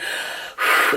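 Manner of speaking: hard huff of breath; exaggerated exhale; heavy breathing. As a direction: imitate someone breathing heavily in a huff. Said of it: "heavy breathing".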